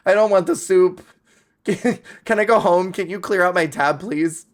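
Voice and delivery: high, loud, laughing plea; mock-panicked diner pleading; panicked customer. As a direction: beg to escape, flustered. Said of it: "they're like".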